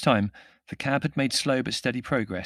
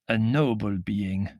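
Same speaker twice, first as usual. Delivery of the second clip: slow, intoned Middle European voice; tall foreign figure; 4 dB louder, slower than usual. A narrator's reading. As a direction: pronounce it grandly, drawing out the words. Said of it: "said the tall figure".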